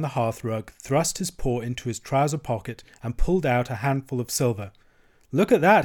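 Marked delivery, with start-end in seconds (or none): 5.3-5.8 high, loud boast; showing-off cry. A narrator's reading. Toad displays his silver, showing-off cry. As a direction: call out proudly to display a prize. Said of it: "he cried, displaying it".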